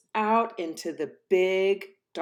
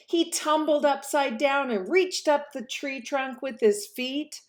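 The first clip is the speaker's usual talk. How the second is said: high, bouncy storytelling; excited narration; 12 semitones higher, 4 dB louder than usual. A narrator's reading. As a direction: tell the tumble with delight.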